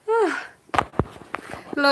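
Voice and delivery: ordinary talk, nothing marked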